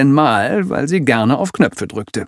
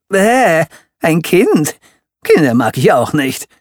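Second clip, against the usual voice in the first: loud, high disgusted rant; 7 semitones higher, 5 dB louder than usual. Acted. Villain complaining about children, loud high ranting. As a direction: bellow your contempt for children. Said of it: "wetterte Ellen".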